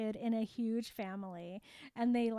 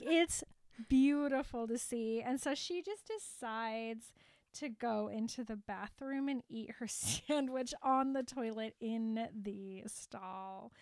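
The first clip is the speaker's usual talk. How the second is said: ordinary delivery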